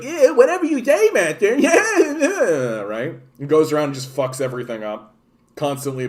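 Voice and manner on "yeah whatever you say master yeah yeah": high, laughing toady voice; Beastly sidekick. Retold or imitated. Beastly fawning to his master, high and giggling. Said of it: "Beastly's like".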